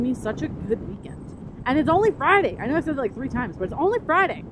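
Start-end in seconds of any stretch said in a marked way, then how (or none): 1.7-2.6 much higher, louder, leaning hard on "only"; excited. Real, not acted. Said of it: "I sound so mad". 2.6-4.4 excited. Real, not acted. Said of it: "I sound so mad".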